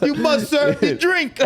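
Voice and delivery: put-on slow, high laughing Jamaican accent; hammed-up Jamaican boss. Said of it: "with a Jamaican accent".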